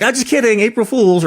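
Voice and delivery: high, sing-song teasing; playful April Fools squeal; mock take-back. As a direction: chirp it like a prank reveal. Mock joke after fake firing.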